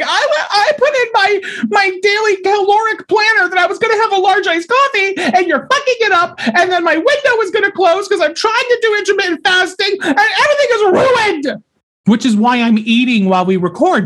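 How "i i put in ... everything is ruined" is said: high frantic laughing rant; meltdown rant. Real, not acted. Host venting about a ruined diet plan, high laughing frantic rant. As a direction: spill it out in a laughing panic. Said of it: "I'm having a meltdown".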